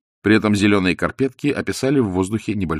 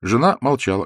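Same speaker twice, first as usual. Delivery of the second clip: usual speaking voice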